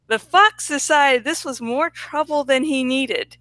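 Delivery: ordinary delivery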